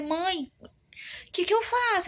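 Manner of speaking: high, strained pleading to mother; desperate plea. Retold or imitated. Daughter at her limit begging her mother, desperate high plea.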